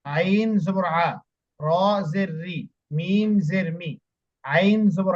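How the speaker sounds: drawn-out, slow spelling of each syllable. Shown as a demonstration. Teacher spelling out আইন জবর আ very slowly for the student. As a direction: stretch each sound out for the student. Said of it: "এভাবে।".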